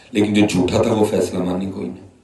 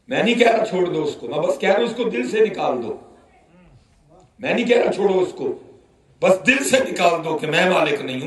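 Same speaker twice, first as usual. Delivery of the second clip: much higher, rising fervour; impassioned preaching; 8 semitones higher than usual. Retold or imitated. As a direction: plead and warn the old man with rising passion. Preacher's heated reply retold. (جوشیلا اور پرجوش خطاب)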